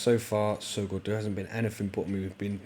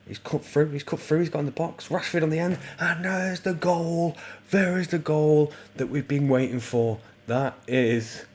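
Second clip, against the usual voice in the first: excited goal commentary; 7 semitones higher, 6 dB louder than usual. Real, not acted. Gamer calling his striker's long-awaited goal, excited shout. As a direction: erupt as the goal goes in, stretching the words.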